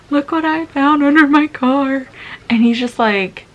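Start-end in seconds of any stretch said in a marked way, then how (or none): 0.1-2.1 higher, louder, mock-innocent plea; fake tearful innocence. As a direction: put on fake tears and play innocent. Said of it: "tried to pull out some fake tears".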